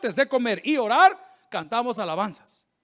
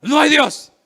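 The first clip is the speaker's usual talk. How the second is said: much higher and louder, proclaiming like the fool; loud mocking cry of the fool; fool's loud declaration; 6 semitones higher, 11 dB louder, slower than usual. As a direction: belt out the fool's boast. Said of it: "dice el necio en su corazón".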